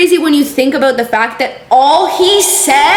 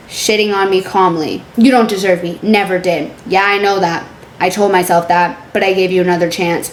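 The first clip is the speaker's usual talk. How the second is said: low, subdued reading of an argument; deadpan text-message readout; 8 semitones lower than usual. Acted. Reading a couple's breakup texts aloud, low and deadpan. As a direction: read both sides low and understated.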